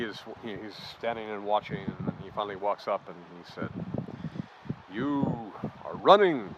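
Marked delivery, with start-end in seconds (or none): 4.9-6.5 slow, measured imitation of a village chief's accented English; mock Amir Khan voice. Storyteller mimicking the chief's slow teasing words to him. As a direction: drag out each word like a chief teasing a foreigner.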